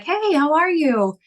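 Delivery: high, slow, sing-song friendly greeting; friendly neighbor. Retold or imitated. New tenant greeting her in the kitchen, high and friendly. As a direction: lightly play the cheerful new tenant. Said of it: "he's like".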